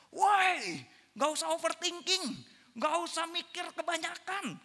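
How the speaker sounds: high-pitched scolding, loud opening call; exasperated mother. Retold or imitated. Mother tempted to scold her son, exasperated. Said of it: "langsung aja tuh ngomong spontan".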